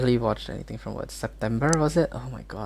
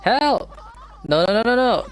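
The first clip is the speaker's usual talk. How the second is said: startled cry for help; 10 semitones higher than usual. Real, not acted. Startled by loud video.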